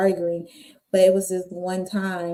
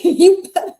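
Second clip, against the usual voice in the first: mama's warning; 12 semitones higher, 8 dB louder than usual. Retold or imitated.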